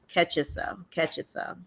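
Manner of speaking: softly, under your breath; quiet self-reminder. Retold or imitated. Coaching herself after a sigh.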